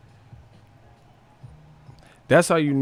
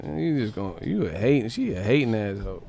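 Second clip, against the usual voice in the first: ordinary talk, nothing marked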